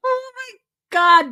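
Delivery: high laughing outburst; squealing with laughter. Real, not acted. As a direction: burst out laughing. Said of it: "I'm, like, in tears right now".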